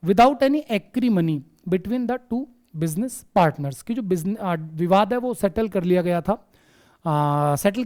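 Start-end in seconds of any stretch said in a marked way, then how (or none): 0.1-3.8 teacher reading an example sentence slowly aloud. Shown as a demonstration. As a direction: read the English sentence very slowly, word by word. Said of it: "example आप देख सकते हैं".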